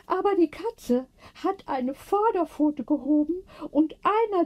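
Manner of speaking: put-on an elderly sister, amazed and moved; high, wondering old-lady voice; voicing Johanna. A narrator's reading. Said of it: "erzählte Johanna".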